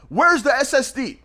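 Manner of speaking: high mock-angry demand; imitating angry customer. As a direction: demand it like an outraged buyer. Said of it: "He emails me cussing me out".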